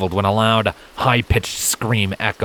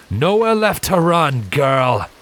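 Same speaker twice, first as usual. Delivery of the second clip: higher, menacing taunt; villain's shout; 8 semitones higher, 3 dB louder, slower than usual. A narrator's reading. Silent taunting the cornered mare, high menacing shout. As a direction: corner her with a sneering call. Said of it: "Silence shouted".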